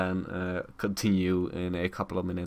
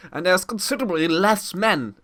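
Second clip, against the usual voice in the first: higher, louder character declamation; lightly acted, raised-voice quote; quoted character declaring; 9 semitones higher, 6 dB louder than usual. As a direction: declare it like a soldier reporting. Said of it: "and it's like".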